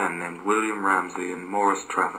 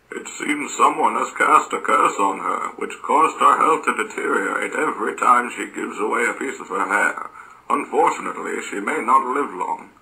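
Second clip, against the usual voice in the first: informing deity; 3 semitones higher than usual. Acted. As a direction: deliver grave news in a raised, put-on voice. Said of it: "one of the deities informs".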